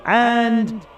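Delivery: big drawn-out announcer voice; stadium announcer. Acted. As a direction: present each name to the crowd. Announcer calls names.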